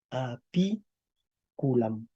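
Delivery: APICULAM lento, sílaba por sílaba y más suave. Shown as a demonstration. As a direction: separa despacio las sílabas de "Apiculam". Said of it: "Tomemos la palabra apiculam". ("Apiculam" en latín, pronunciado muy despacio)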